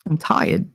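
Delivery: "tired" as "TIE-id", R swallowed; Jersey "tired". Shown as a demonstration. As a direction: repeat "tired" without its R. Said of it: "make fun of me 'cause I say".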